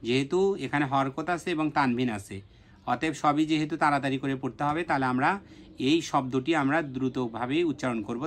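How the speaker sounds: ordinary talk, nothing marked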